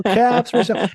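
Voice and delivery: high, slow sing-song street cry; peddler's call. Retold or imitated. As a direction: call it out like a hat seller. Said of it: "call out to her when she was little, be like".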